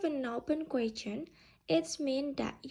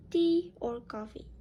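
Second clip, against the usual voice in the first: "Tea" high, "coffee" dropping; short choice question falling on "coffee"; choice-question intonation; 4 semitones higher, 4 dB louder than usual. Shown as a demonstration. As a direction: punch "Tea" up, let "coffee" fall. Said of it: "intonation can drops on the second option".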